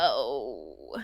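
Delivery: long, low retold oh; ominous reaction. Cohost retells reacting to dark comedy, low drawn-out oh. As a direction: drop low and draw it out. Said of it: "And I'm like".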